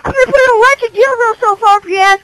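high, loud raging yell over the mic; enraged gamer shrieking insults; furious screaming. Real, not acted. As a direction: scream it at the traitor with everything. Betrayed player screaming at teammate.

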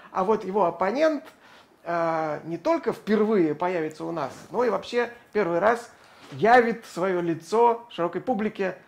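ordinary talk

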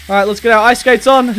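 ordinary talk

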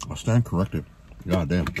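speaking between bites; talking while chewing. Real, not acted. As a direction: mouth full of pizza. Seen 0: Bites pizza at -1.0, then chews while talking, slice in hand.

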